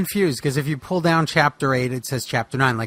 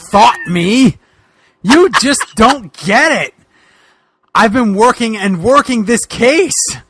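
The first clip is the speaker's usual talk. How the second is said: louder, slow, hammed-up detective rant; a touch higher, exasperated Boogeyman; frustrated detective rant; 4 semitones higher, 7 dB louder, slower than usual. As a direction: vent like a cop at the end of his rope. S1 voices Boogeyman's outburst.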